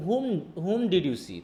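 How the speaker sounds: "Whom" drawn out slowly and repeated for weight; stress on Whom. Shown as a demonstration. Teacher shows the question being answered, stress on "Whom". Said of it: "তখন সেটা গুরুত্ব দিচ্ছি".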